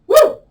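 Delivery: loud, high "woo" on release; relieved whoop. Real, not acted. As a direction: let out a big high whoop. Whoops after the hard exercise.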